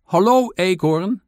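high, loud returned greeting; calling back. A narrator's reading. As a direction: shout hello back over the fence. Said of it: "riep de mier terug".

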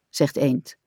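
ordinary delivery

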